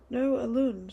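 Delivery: sharp loud scream of his name; Marley screams to stop Elun walking into the mist, loud. A narrator's reading. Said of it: "screamed Marley".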